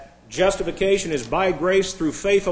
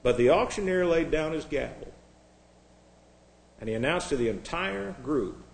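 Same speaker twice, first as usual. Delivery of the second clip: ordinary talk, nothing marked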